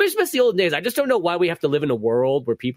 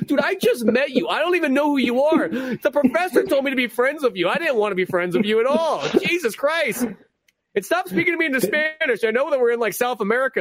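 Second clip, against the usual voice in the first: exasperated player; 8 semitones higher than usual. Retold or imitated. The player pleading with the clingy rival, high and exasperated. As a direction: push her away in a panicked high voice.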